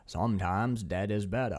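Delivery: put-on creepy stranger in a dragged Maine accent; mock-ominous Maine-accented line, ending in a laugh; imitated Maine accent. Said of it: "then he's like".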